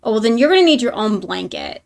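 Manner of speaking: usual speaking voice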